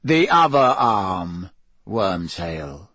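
slightly drawn-out cold order, dropping at the name; cold, cruel villain. Acted. Dark lord corrects his cowering servant, coldly unhurried. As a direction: let the name fall low, with cruel calm. Voices Voldemort.

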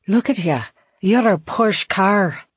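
high, slow Scottish voice; thick Scottish brogue; Scottish accent. Retold or imitated. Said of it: "He had a thick Scottish accent".

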